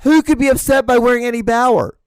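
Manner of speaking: high, loud comic disbelief; incredulous outburst. Real, not acted. Comedian asking about his own Eddie Bauer clothes, incredulous outburst. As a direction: cry it out as an absurd question.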